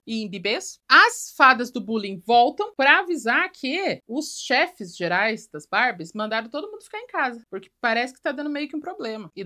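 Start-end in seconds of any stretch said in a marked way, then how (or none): none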